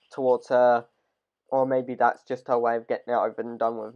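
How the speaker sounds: ordinary talk, nothing marked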